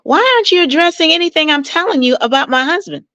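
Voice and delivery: high, fast, exasperated mock complaint; frustrated complaint. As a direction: fire it off like an aggrieved wife. Client's frustrated complaint retold.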